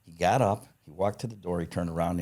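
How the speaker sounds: normal talking voice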